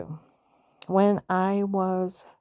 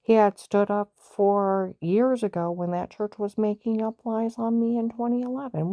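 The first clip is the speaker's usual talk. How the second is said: usual speaking voice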